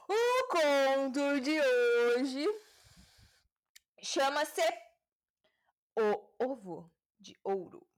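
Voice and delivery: stretched words with theatrical pauses; grand title announcement. Pretend. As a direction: announce it slowly like a grand presenter.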